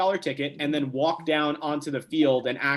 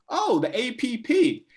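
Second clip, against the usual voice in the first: knowing surprise; 9 semitones higher than usual. Retold or imitated. Sal recognizes local paper.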